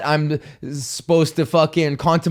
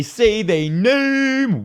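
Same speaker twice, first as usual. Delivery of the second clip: drawn-out hype call; 7 semitones higher, much slower than usual. Acted. Hyping up Patreon supporters.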